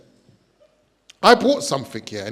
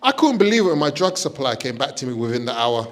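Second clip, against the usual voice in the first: ordinary talk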